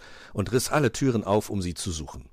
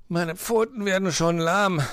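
higher, strained weary moan; groaning; 12 semitones higher, 4 dB louder than usual. A narrator's reading. Fox tires shaking the beds, groaning. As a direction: moan it with tiring effort. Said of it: "stöhnte Herr Fuchs".

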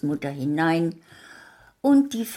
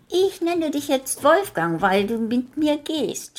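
put-on high, bright child voice throughout; little girl talking; 11 semitones higher, 3 dB louder than usual. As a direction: chatter kindly as the little girl.

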